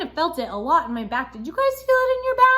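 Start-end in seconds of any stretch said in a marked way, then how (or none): 1.3-2.5 cheery teacher question. Real, not acted. Teacher asking kids cheerfully.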